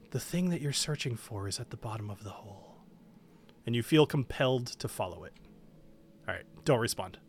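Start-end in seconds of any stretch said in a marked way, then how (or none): 0.1-2.8 soft, low, soothing voice in the head; gentle voice. Acted. Game master as a voice in a player's head, gently. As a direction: speak gently, well under your usual volume and pitch. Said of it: "gentle voice saying".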